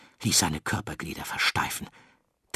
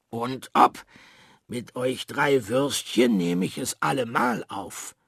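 louder, higher swagger of a fake tough; boastful bluster; 5 semitones higher, 3 dB louder than usual. A narrator's reading. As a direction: brag at the three sausages. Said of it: "tönte der kleine Regenwurm". (großspuriges Tönen)

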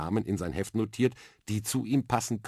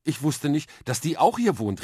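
put-on higher, louder amazed girl; excited exclamation; 9 semitones higher, 6 dB louder than usual. Mia hears Wonder Woman lives here, excited call. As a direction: burst out in wonder. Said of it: "rief das Mädchen".

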